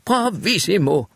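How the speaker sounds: high cheer of bravissimo, mildly voiced; a touch of a delighted old woman's cry; cheering shout. A narrator's reading. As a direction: applaud her like at the opera. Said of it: "råbte Mary".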